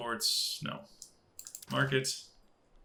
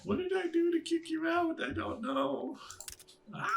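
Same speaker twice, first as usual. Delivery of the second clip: much higher, mock plaintive asking; high put-on voice. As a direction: pretend to be the hurt little character. Talking to a game character.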